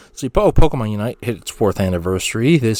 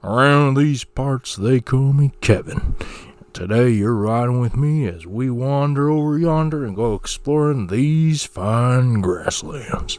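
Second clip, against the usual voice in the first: cowboy twang intro. Acted. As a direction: greet listeners like a ranch hand. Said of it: "doing my little cowboy twang intro".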